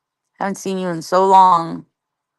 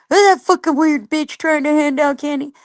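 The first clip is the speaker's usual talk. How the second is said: lightly voiced sneering heckler, a bit higher; mocking heckler; 6 semitones higher than usual. A hint of a jeering commenter mocking her. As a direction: mimic a troll jeering at her. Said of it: "Somebody was clowning me about".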